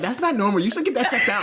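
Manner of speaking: high, laughing mock of his wife. Husband laughing as he mimics his wife's worry. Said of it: "She was like".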